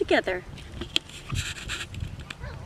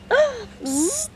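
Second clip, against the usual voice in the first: gasp; 11 semitones higher than usual. A narrator's reading. Narrator gasps at bee.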